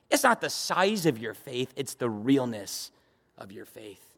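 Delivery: low, soft, reverent close to a point; hushed preaching. Real, not acted. Pastor tells the congregation of a sincere man, hushed low preaching.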